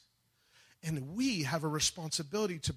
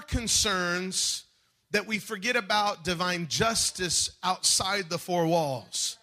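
preaching at full force; 5 semitones higher, 6 dB louder than usual. Acted. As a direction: hammer each word at the church. Preaching loud and slow.

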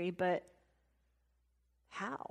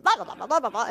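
mock Italian comeback; much faster than usual. Old man yelling back at the driver, mock babble. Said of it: "the old man starts giving it back to him".

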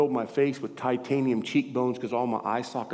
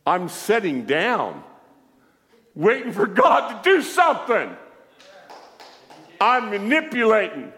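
building from raised voice to high yell; incredulous shouting; 8 semitones higher, 6 dB louder than usual. Real, not acted. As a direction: protest loudly, pitched way up. Indignant mock protest at skeptic's charge.

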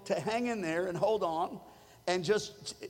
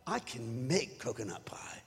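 lower, softer, breathless whisper; whispered revelation; 9 semitones lower, 8 dB quieter than usual. Retold or imitated. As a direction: whisper the discovery like a secret. Realization whispered.

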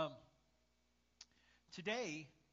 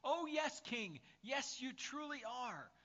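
high, emphatic, eager agreement; theatrical yes-man; fawning courtier; 8 semitones higher, slower than usual. Acted. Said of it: "would flatter him all the time".

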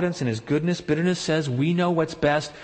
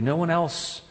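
ordinary talk, nothing marked

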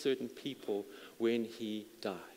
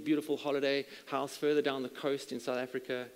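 ordinary talk, nothing marked

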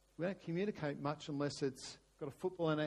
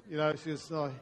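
normal talking voice

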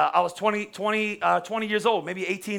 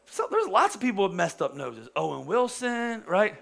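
usual speaking voice

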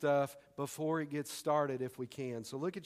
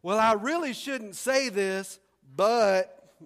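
louder, slow, hammed-up false reluctance; milk the "but" before spilling; fake-modest tease before the juicy news; mock reluctant gossip; 4 semitones higher, 8 dB louder, slower than usual. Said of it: "I love this one".

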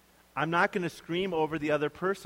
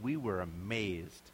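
awed hush; 8 semitones lower, 6 dB quieter, slower than usual. Retold or imitated. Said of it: "they walked away saying".

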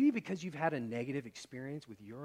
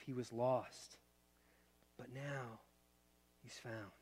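gentle father; 3 semitones lower, 6 dB quieter than usual. Acted. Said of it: "gentle word to use".

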